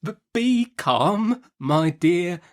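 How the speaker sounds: put-on softest accents. A narrator's reading. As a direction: stammer gently to calm her. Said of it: "in his softest accents".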